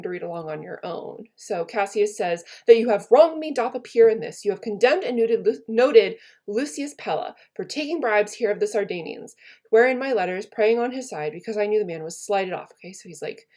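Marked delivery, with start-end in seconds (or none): 2.7-12.7 louder, declaiming Cassius's accusation; dramatic Shakespeare reading. Acted. Teacher reading Cassius to her class, dramatic recital. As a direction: read it out like a wronged general.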